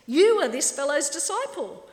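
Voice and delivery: loud, high scornful accusation; insulting jeer. Acted. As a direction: spit it out as an insult. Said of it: "they come back with something".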